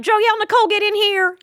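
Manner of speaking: put-on high-pitched parent calling a child in; mamma che chiama. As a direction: call out the full name like a summoning mom. Voicing a mother calling the child.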